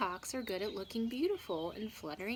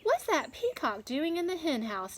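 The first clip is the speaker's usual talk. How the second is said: farmer asking; 8 semitones higher, 6 dB louder than usual. Acted. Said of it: "asked Farmer Tucker".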